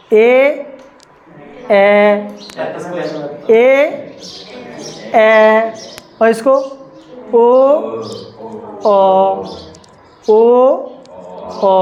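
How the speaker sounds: ए and ओ held the same length each time; equal length vowels. Shown as a demonstration. Teacher asks students to hear equal timing. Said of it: "बोलने में time कम ज़्यादा".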